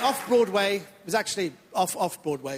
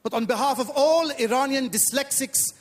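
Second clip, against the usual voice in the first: put-on comic Iranian accent, eager to please. Comedian playing Iranian immigrant to the audience, put-on Iranian accent. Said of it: "a bit of an accent I do at the beginning".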